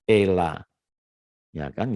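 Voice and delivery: normal talking voice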